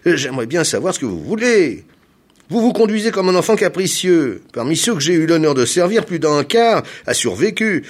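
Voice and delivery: loud, high, severe reproach; stern. A narrator's reading. Hair planter reproaching his reluctant client, stern. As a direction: scold like dealing with a spoilt child. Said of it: "d'une voix sévère".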